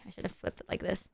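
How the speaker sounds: soft whispered self-correction; whispered aside. Real, not acted. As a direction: whisper it to yourself. Muttered aside while flipping card.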